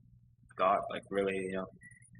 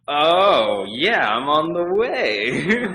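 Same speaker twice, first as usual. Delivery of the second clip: loud, high, slowly stretched singsong cover-up; exaggerated bluffing; 8 semitones higher, 14 dB louder, much slower than usual. Client bluffing to hairdresser about forgotten appointment, exaggerated. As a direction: drag it out high and loud, as if remembering.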